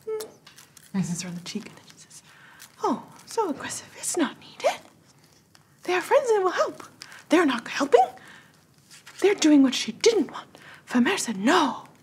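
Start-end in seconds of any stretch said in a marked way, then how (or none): 2.8-11.9 gentle fairy voice. Acted. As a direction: chide them lightly in a high voice. GM voices Fernweaver scolding softly.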